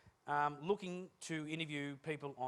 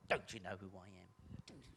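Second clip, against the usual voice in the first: slow, low indignant demand; mock angry; 7 semitones lower, 7 dB quieter, slower than usual. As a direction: play the offended big shot. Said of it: "the angry dial".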